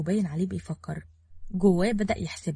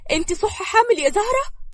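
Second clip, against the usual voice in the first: very high, loud delighted question; squealing-high excitement; overjoyed; 14 semitones higher, 6 dB louder than usual. A narrator's reading. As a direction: burst out with happy disbelief. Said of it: "وحضنتها بفرحة وقالت".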